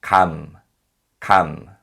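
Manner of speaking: "Kam" said clearly with a closed final m; model syllable kam. Shown as a demonstration. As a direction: model "Kam" slowly for learners. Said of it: "Here's an example".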